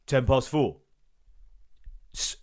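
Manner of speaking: usual speaking voice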